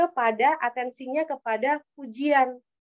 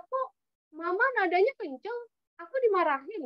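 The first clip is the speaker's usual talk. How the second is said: put-on child protesting; 8 semitones higher than usual. Child voiced complaining to mom.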